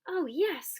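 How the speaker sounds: high, loud oh yes; delighted kids. A narrator's reading. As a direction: cheer it out. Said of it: "cried the kids".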